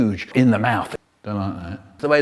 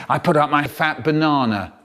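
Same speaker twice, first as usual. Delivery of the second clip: ordinary talk, nothing marked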